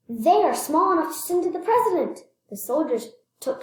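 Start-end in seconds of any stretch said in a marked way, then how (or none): 0.1-2.2 eager. Acted. Clark eager to catch one.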